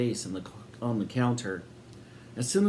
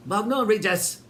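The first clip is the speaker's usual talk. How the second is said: high, loud irritated snap; snapped; 9 semitones higher, 4 dB louder than usual. A narrator's reading. Twin snapping at her sister, high and loud. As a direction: bite the words off at her sister. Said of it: "she snapped again".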